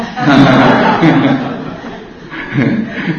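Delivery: rising laugh at his own joke; amused chuckle rising; hearty laugh. Real, not acted.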